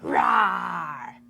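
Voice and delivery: loud growling dinosaur roar. Shown as a demonstration. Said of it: "start practicing your best dinosaur roars".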